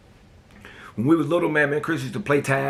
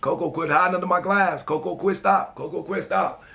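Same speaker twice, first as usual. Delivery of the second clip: mama yelling; 5 semitones higher than usual. Retold or imitated. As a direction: scold the boy to stop hiding under the glass. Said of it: "She kept telling me".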